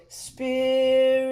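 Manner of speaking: spirit sung on a pure frozen i vowel, no R; frozen glassy vowel. Shown as a demonstration. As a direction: hold the i in spirit, skip the R. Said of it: "our next frozen vowel".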